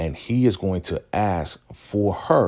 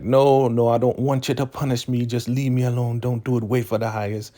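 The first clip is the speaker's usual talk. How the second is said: frantic pleading; 6 semitones higher than usual. Retold or imitated. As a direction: imitate someone begging to be spared, voice pitched up. Acted plea against being punished.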